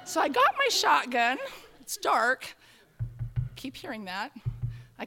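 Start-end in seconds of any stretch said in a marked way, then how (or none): none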